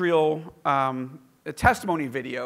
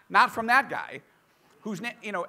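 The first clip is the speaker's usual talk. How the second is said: normal talking voice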